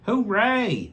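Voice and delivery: high, loud hooray; the garden friends cheering the wedding news; cheering bugs. A narrator's reading. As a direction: squeal it with joy. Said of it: "said all our friends".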